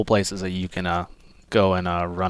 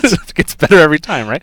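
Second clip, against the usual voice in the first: laughing; 5 semitones higher, 6 dB louder than usual. Real, not acted. Laughing at the name.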